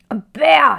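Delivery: loud, high yell of pain; roar of pain. A narrator's reading. Mister Curry burned by cocoa, loud pained roar. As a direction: howl it as the hot cocoa burns. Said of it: "he roared".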